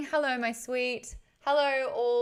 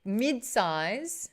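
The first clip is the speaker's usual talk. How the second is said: usual speaking voice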